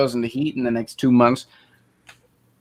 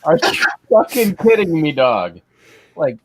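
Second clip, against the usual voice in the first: hammed-up disbelief, pitched up at the start; mock exasperation; 5 semitones higher, 3 dB louder than usual. Fed up with the dog flopping on the roller, mock exasperated. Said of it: "But I'm like".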